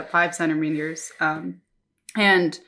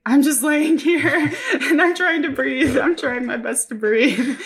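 high, slow, laughing through the words; 7 semitones higher, 3 dB louder than usual. Retold or imitated. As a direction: giggle while pretending to struggle. Retold labor, laughing at herself.

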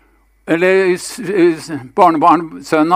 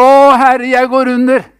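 Peter's sinking cry, pitched high and louder; retold cry for help; 7 semitones higher, 5 dB louder than usual. Peter sinking in the sea cries to his Lord, loud and high. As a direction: call out "Å, Herre" in alarm, up high. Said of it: "så roper han".

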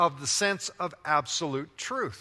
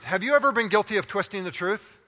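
ordinary delivery

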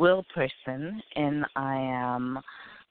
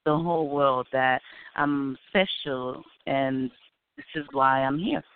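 ordinary delivery

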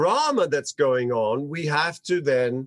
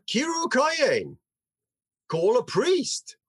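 commanding call; 8 semitones higher than usual. Acted. Eli ordering a priest called.

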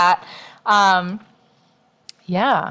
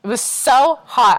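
drawn-out complaint; 5 semitones higher, 3 dB louder, slower than usual. Real, not acted. Repeats complaint about heat.

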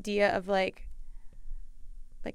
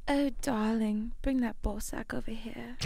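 put-on British accent; 5 dB quieter than usual. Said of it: "I wanna do one after you".

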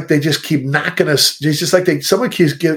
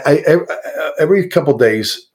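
usual speaking voice

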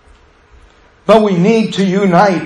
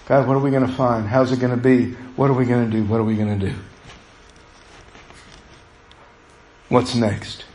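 quiet anxious thoughts; 5 semitones lower, 6 dB quieter, faster than usual. Retold or imitated. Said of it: "my mind's still".

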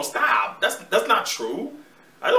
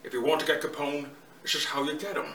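Connery as Untouchables cop; 4 semitones lower, 4 dB quieter than usual. Acted. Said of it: "less The Untouchables".

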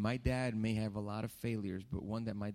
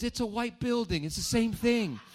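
put-on stubborn old man, higher and louder; high mock dad voice; 12 semitones higher, 6 dB louder than usual. Preacher imitating his father insisting on the building, high and loud.

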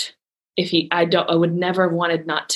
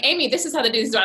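lightly voiced know-it-all friend, higher; mimicking friend; 8 semitones higher, much faster than usual. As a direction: play the friend explaining matter-of-factly, pitched up. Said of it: "you're like".

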